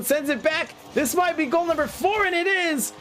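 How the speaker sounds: rising to a shouted goal call; excited goal call. Real, not acted. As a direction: build up and yell the goal. Goal call building to climax.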